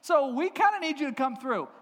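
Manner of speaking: high, loud mock plea; crying out. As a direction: call up to heaven in comic desperation. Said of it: "cry out to the Lord saying".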